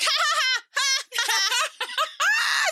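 high, put-on cackle; hammed-up high laughter; mock laugh. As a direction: laugh it out like a cartoon. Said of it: "He's like".

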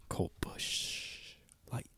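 hushed unvoiced whisper; soft whispered aside. Real, not acted. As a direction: whisper it under your breath. Cracking a beer, whispered aside.

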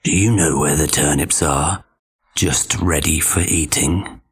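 low, sly wolf voice. Acted. Wolf tricking the pig.

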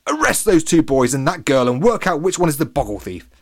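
ordering. A narrator's reading. Latham sends the policeman after three children, ordering. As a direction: issue a forceful command. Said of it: "he ordered".

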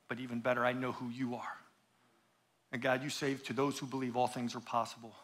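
hushed, low-pitched prayer; reverent prayer. Real, not acted. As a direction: drop right down and pray softly to God. Leads congregation in prayer.

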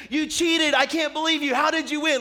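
high, loud whiny accusation; kid's angry protest. Retold or imitated. Younger brother yelling at the brother who beat him, whiny and loud. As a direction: protest like a sore loser kid.

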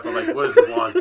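high wheezy laugh on the words; breathless with laughter. Real, not acted. Laughing while telling.

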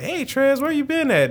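put-on high kid voice; squeaky young cousin greeting; little cousin voice. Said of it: "And he like".

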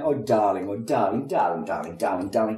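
mock sweetie-darling theatre voice; luvvie darling. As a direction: gush like a luvvie actor. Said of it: "sweetie darling sort of Patsy".

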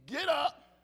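hammed-up prosperity preacher, shouting get up; mock revival shout. Preacher mocking what church made of get up, shouted. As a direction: bellow it like a showy church promise.

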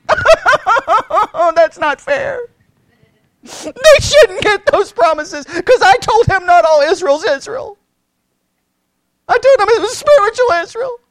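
high, loud mock tantrum; whiny kid. Mocking critics as a sulking child, a loud whiny tantrum. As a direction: whine like a child denied a treat. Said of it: "they throw a fit like a kid".

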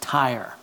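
"Tyre" rhymes with fire, said quietly and falling; pronounced Tyre. Shown as a demonstration. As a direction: say "Tyre" as one syllable, like "tire". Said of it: "that's the way it's pronounced".

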